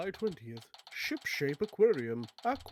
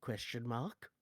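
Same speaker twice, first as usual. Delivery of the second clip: much softer and lower; quiet; 7 semitones lower, 11 dB quieter than usual. Real, not acted. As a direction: mumble it under breath. Rereading location card quietly.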